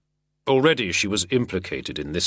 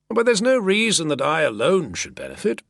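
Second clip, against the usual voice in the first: sardonic drawl; 10 semitones higher, 4 dB louder than usual. A narrator's reading. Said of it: "still in that artful, sardonic drawl".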